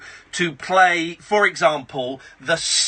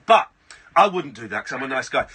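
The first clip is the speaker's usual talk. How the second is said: ordinary talk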